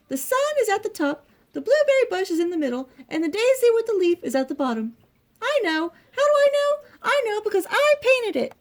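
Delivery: high little storybook mouse voice; Little Mouse, put out. A narrator's reading. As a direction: explain insistently, pitched high throughout. Little Mouse speaks to the elm.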